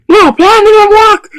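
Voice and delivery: high, loud wail over the failed plan; frustrated shout. Pretend. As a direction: shout the letdown at the top of the voice. Toy play, plan fails.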